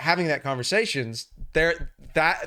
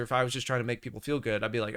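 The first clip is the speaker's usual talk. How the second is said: normal talking voice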